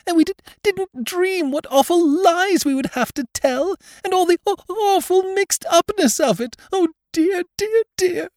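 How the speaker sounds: high, stammering sobs; sobbing. A narrator's reading. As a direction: choke the words out through tears. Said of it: "sobbed Missus Breed".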